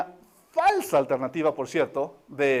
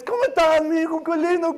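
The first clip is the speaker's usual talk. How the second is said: higher and louder, delighted; gushing parent; 9 semitones higher, 6 dB louder than usual. Retold or imitated. Proud father gushing over his son, high and loud. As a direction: gush over the child.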